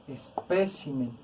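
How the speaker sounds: "espécimen" slow and soft, weight on PÉ; stress on PÉ. Shown as a demonstration. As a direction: lean on the second syllable of "espécimen", then fall. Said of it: "Es una palabra esdrújula".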